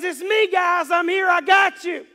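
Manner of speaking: loud, high shouted reassurance; calling out. Acted. Preacher acts Jesus calling to frightened disciples, calling out. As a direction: shout it across a storm to friends.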